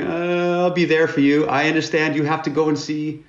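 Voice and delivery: high, loud blubbering plea; hammed-up sobbing promise from a dorky kid; mock crying nerd. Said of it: "he's crying with his braces like this".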